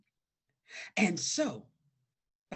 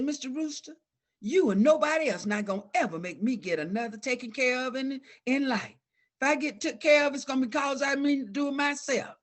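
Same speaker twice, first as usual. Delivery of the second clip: put-on higher, louder, a child standing her ground; defiant speech; 7 semitones higher, 4 dB louder than usual. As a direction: tell the rooster off with pride. Girl defies the rooster aloud.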